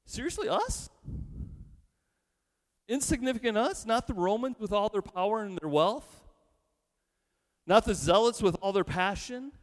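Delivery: high, disbelieving protest, pitch leaping up; incredulous disbelief. Pretend. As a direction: play the crowd who can't believe they're chosen. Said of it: "They say".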